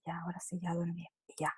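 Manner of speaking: soft unvoiced whisper; a child whispering goodnight to her mother; whispered child's reply. Child voiced settling to sleep.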